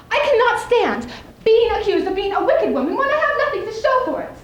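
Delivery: high, loud, exasperated protest; indignant outburst. Acted. Milliner protesting she's called wicked for nothing, high and exasperated. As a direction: throw the unfairness up high and loud.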